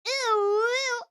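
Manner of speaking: slow, high swooning thanks; playful exaggerated coo; hammed-up swoon. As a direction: drag out the ooh like a flattered diva.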